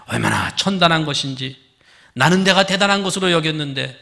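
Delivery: normal talking voice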